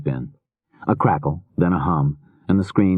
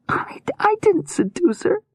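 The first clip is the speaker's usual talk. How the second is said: terrified whisper. Acted. Terrified captive protests.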